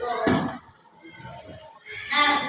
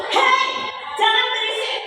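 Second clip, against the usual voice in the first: startling telling-off; 7 semitones higher than usual. Shown as a demonstration. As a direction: startle the class into hushing. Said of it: "Kira-kira kalian kaget nggak?".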